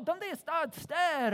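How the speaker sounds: slow, hammed-up Spanish phrase; a jokey put-on Spanish accent; mock Spanish. Preacher mocking Spanish speakers, hammed-up slow accent. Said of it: "or, or Spanish".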